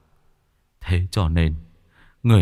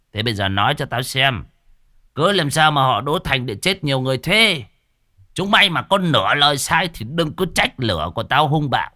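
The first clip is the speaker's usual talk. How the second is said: higher, pushing threat at the ghosts; bully them into talking; menacing growl; 7 semitones higher than usual. Acted. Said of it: "Lão Toét cũng hầm hè".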